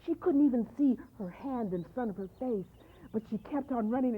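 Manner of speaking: plain reading voice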